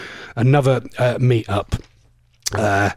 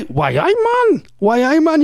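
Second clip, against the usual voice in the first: high-pitched, badly faked Geordie catchphrase; attempted Geordie accent; 13 semitones higher than usual. Shown as a demonstration. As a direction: practise a hammed-up Geordie accent. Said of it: "I can't do accents".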